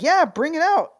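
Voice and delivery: excited. Retold or imitated. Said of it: "And I was like".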